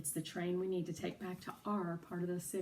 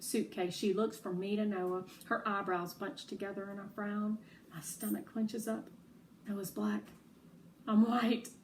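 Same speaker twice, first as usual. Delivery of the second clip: ordinary delivery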